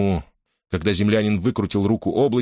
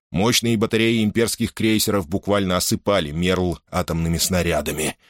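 usual speaking voice

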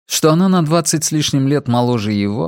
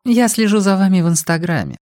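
higher-pitched voice for the woman; woman explaining; 8 semitones higher than usual. A narrator's reading. Woman explaining herself to Rex, high character voice. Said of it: "пояснила женщина".

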